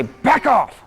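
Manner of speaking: a firm, mean "Back off."; mean voice demo. Shown as a demonstration. Teacher showing the mean delivery, firm and stern. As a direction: model it with a hard stare. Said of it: "But now give her a mean look".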